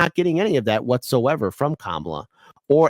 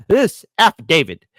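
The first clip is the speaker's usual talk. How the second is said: emphatic reading; 5 semitones higher, 7 dB louder than usual. Real, not acted. Reading key document aloud.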